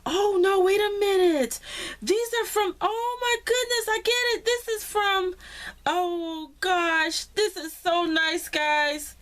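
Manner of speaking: high, loud, astonished outburst; delighted surprise. Real, not acted. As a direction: gush loudly as the surprise dawns on you. Realizes who sent surprise wedding cards.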